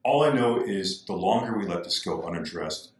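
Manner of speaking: ordinary talk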